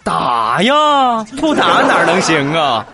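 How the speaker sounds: loud, laughing matter-of-fact answer; calm matter-of-fact. Retold or imitated. Mom tells the host casually that she spanks her kid. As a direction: say it like it's obvious, with a laugh. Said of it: "彩彩很淡定地说".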